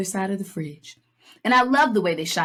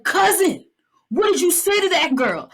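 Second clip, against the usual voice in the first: much higher, louder accusing call; Richie's accusation; 11 semitones higher, 4 dB louder than usual. Retold or imitated. Podcaster voicing Richie scolding his cousin, high and loud. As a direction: demand it like a cousin caught him. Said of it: "He's like".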